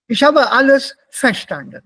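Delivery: loud, high demo with verstanden hit on VER; misplaced stress on VER. Shown as a demonstration. Teacher imitating students' mistake, verstanden stressed on VER. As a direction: punch the first syllable of verstanden. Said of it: "das ist nicht richtig ausgesprochen".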